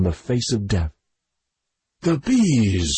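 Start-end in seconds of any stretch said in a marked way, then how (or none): 2.0-2.9 higher, falling cry of despair; moan of despair. A narrator's reading. Doctor sees the swarm attacking, moaning in despair. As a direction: moan it out in dawning horror. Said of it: "as a moan of despair".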